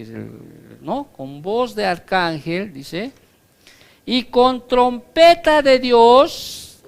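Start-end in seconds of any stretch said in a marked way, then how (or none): 4.1-6.8 "trompeta de Dios" rung out loud, high and slow; trumpet proclamation. Acted. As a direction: announce "trompeta de Dios" like a fanfare. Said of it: "otra cosa que acompaña al rapto". ("y con trompeta de Dios", fuerte, agudo y lento)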